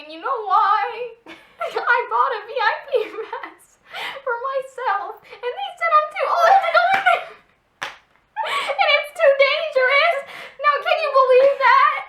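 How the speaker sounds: put-on high, loud old lady voice with giggles; hammed-up old lady. Friend voices the elderly fan, hammed-up high. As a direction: play a chatty old fan, overdone.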